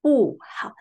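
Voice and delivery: 不好 with falling 不 and dipping 好; bu hao demo. Shown as a demonstration. Teacher modelling 不好 for the class. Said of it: "We pronounce 不".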